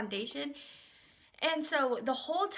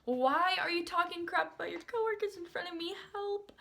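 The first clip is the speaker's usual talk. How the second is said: exasperated plea climbing higher; high-pitched pleading; 6 semitones higher than usual. Retold or imitated. Customer stuck hearing staff gossip, high-pitched pleading. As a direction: push the pitch up, helplessly exasperated.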